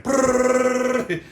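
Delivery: loud high imitated gunfire rattle; machine gun brrr. Mimicking a machine gun, loud rattling brrr.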